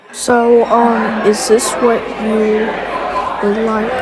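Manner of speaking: loud, eager fast-food cashier. Acted. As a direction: shout the order back like a cashier.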